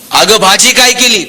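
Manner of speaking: normal talking voice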